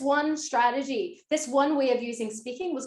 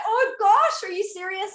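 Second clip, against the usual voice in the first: mock dismay; 7 semitones higher, 5 dB louder than usual. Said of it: "I was just like".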